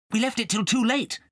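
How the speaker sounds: a hint of an excited cat; lightly voiced Nibbins. Nibbins longing to join the dance, lightly voiced.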